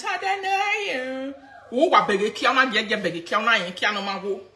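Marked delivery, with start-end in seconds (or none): 0.0-1.3 mocking high voice. Mocking rival in live rant.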